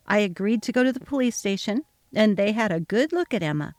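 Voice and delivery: usual speaking voice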